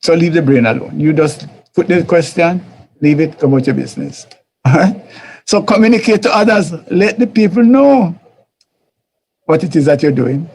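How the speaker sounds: ordinary talk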